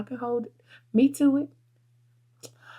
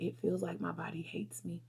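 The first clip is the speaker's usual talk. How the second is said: whispered despair; 11 dB quieter than usual. Retold or imitated. Recalling her colon recovery.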